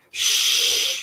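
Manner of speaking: unvoiced warning hush; man shushing Milo so nobody overhears; cautioning shush. A narrator's reading. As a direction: hush the boy urgently. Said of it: "he cautioned".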